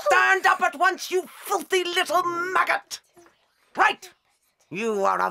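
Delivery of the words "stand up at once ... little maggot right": enraged headmistress; furious shout. Acted. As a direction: scream the order at her. Ordering pupil up.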